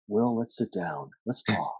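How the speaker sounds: soft, slow therapist voice; hushed imitation of a soothing psychologist. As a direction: talk a patient down, quietly and slowly. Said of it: "like, psychologist voice".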